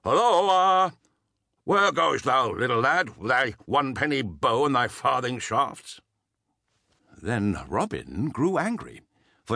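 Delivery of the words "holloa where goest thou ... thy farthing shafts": put-on loud jeering call to a boy, mouth full; mocking forester calling out. A narrator's reading. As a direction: taunt the lad from across the glade. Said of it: "called out to Robin".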